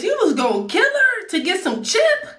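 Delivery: laughing, high-pitched disbelief at the robber; half-laughing, talk down to the robber; mock scolding the robber.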